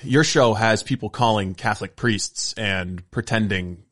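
usual speaking voice

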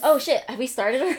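loud startled exclamation; surprised. Real, not acted. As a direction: caught off guard, blurt it out. Caught off guard by recording.

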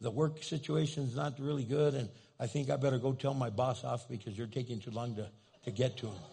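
lightly mocked nagging prayer; mock whiny prayer. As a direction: pester God like an impatient believer. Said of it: "Have you ever prayed like that?".

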